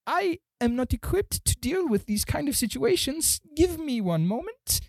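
very high-pitched little gnome voice; gingerly gnome clerk. Acted. Gnome excusing himself from visitors, high and uneasy. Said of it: "He gets up from his chair very gingerly and says".

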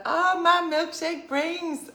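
high, loud, drawn-out come-on; mock flirty impression. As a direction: croon it as a hammed-up flirt. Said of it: "It's like".

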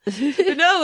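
ordinary delivery

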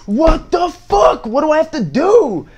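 very high, loud, drawn-out yell of disbelief; exasperated shout. Retold or imitated. To the kid who won't react, retold exasperated shout. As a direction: re-enact yelling in utter exasperation, stretching the words.

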